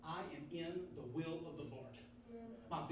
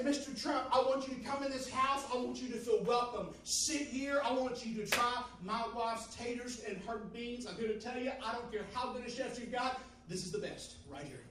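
eager host welcome; 6 semitones higher, 5 dB louder than usual. Pretend. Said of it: "you would say".

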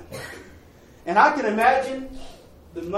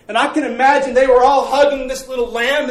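ordinary delivery

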